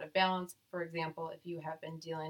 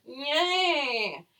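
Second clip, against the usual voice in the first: excited cheer; 8 semitones higher, 8 dB louder than usual. Real, not acted. Cheering the rare result.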